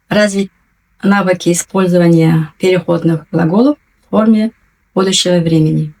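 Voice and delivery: usual speaking voice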